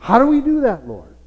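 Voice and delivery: high, loud mock plea; mock questioning. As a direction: act out a baffled question to the Lord. Acts out questioning the Lord.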